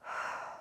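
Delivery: soft, slow exhale; deep breath. Retold or imitated. Toddler taking a deep breath when told, soft exhale. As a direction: let a breath out quietly. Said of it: "she would take a deep breath".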